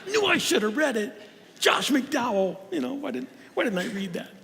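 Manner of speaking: whiny self-reproach, hammed up; imitating a nervous believer who skipped the homework; fretting churchgoer. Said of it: "they forgot to read that Lee Strobel book".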